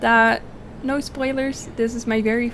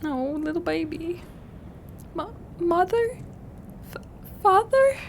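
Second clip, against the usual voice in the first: put-on child; 9 semitones higher than usual. Voices child NPC.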